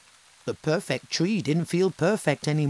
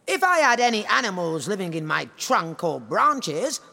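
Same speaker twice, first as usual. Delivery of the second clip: higher, louder haughty tree voice; vain tree; 7 semitones higher, 3 dB louder than usual. Acted. The tree refusing animals, haughty and high. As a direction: sniff it out haughtily. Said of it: "it said".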